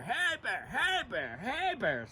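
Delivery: high, shouted hey bear calls; scaring off a bear. Retold or imitated. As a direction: yell to scare a bear away. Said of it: "yelling at the bear".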